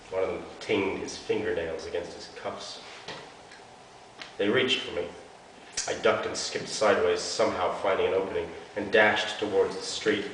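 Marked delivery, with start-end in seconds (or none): none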